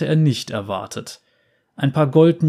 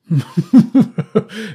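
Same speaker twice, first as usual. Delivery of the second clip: loud booming belly laugh; 9 semitones higher, 7 dB louder than usual. A narrator's reading. Bounty hunter bursts out laughing at the girl, loud booming laugh. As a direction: roar with laughter. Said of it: "dröhnte das Gelächter".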